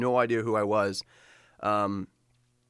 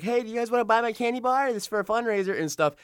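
put-on much higher, bright-eyed child's sales pitch at the door; eager kid salesman; 10 semitones higher than usual. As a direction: play the little kid ringing doorbells, all eager. Kid selling candy door to door.

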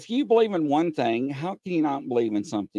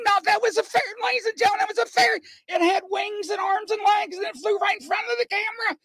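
much higher, shouted disbelief; excited shout; faster than usual. Retold or imitated. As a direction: insist to the audience, wide-eyed. Retelling seeing a fairy.

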